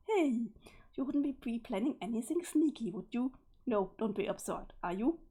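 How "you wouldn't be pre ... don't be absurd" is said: ordinary delivery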